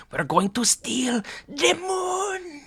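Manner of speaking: slow, high, hammed-up Gru accent; cartoon villain with a bad Gru accent; terrible Gru accent. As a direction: do a badly faked Gru accent, very drawn out. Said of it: "That was a terrible Gru accent".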